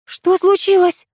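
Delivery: high, slow and frightened; alarmed friend asking from the dark. A narrator's reading. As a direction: ask in alarm, unsure what's there. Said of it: "испуганный голос Тамары".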